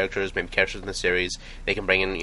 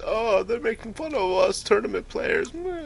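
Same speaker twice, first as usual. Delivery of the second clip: high-pitched mock whining; whiny voice. Mimicking offended tournament players to a co-host, high and whiny. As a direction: whine it like an aggrieved complainer. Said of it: "Good whiny voice".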